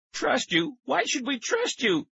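very high, indignant cry. A narrator's reading. Tara refusing to trust the stranger, crying out. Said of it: "Tara cried".

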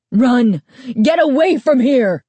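high, loud frantic shout; panicked yelling. Acted. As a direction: yell at her to get out. Shopkeeper screaming warning.

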